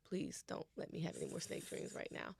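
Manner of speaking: hushed, near-whispered plea; asking God. Pretend. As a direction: pray quietly, half in jest. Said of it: "I'm just asking God".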